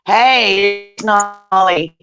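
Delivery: usual speaking voice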